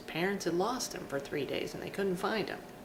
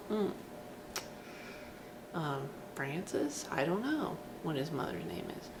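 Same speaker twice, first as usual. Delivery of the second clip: stumped guessing; 3 semitones lower, 6 dB quieter than usual. Retold or imitated. Fan stumped by butler's question.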